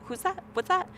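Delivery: high, puzzled who's that; retold surprised clerk asking; surprised question. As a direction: pitch it up in confusion. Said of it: "she's like".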